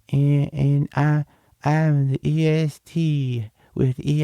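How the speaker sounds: mock Bianca promo. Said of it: "She's like".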